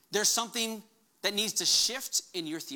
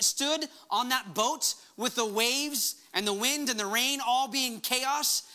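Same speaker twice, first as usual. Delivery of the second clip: usual speaking voice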